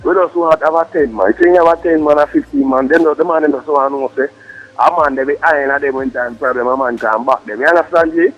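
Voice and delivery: ordinary talk, nothing marked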